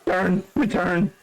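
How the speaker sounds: voice cracking. Real, not acted. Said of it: "I hate when my voice cracks".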